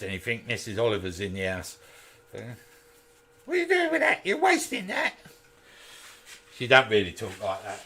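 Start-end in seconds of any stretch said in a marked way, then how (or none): 3.4-5.1 much higher, nagging mock voice of her mother; scolding mother-in-law. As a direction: squeak out the mother's telling-off. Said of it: "That was her mother".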